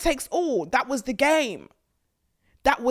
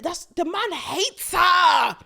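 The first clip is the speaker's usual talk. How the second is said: slow, higher and louder; outraged shout; 8 semitones higher, 3 dB louder, much slower than usual. Real, not acted. Vlogger shouting that the man hates her. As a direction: blurt out the outrage.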